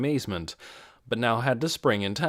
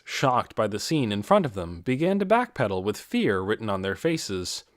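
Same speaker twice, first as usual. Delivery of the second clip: ordinary delivery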